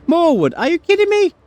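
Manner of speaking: really, again? throw it up high; exasperated disbelief. Real, not acted. More junk loot in game.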